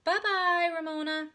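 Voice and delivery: put-on high, loud sing-out to a toddler; cooing farewell. A narrator's reading. Missus Whizzer bids small Ramona goodbye, high cooing call. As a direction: call it brightly to a tiny child. Lady calling farewell to child.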